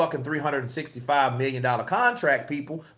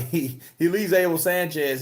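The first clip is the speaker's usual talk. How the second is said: usual speaking voice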